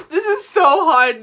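high laughing wail; laugh-cry about the struggle. Real, not acted. Laughing-crying over makeup struggle.

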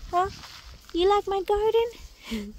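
slow high doggy voice asking the dog; cooing pet talk. Real, not acted. Asking her dog again.

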